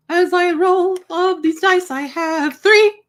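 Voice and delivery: put-on Irish accent. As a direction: roll the dice in a playful Irish brogue. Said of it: "it just triggers my Irish".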